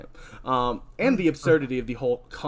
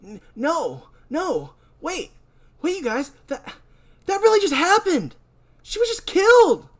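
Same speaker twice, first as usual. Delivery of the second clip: high, loud, urgent; panicked insisting; 6 dB louder than usual. Acted. Boy insisting to partygoers she was killed. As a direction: beg them to believe it.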